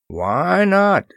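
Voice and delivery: ordinary delivery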